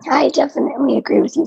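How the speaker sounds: raspy, strained voice; hoarse from a tired throat; losing voice. Real, not acted. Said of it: "I'm losing my voice today".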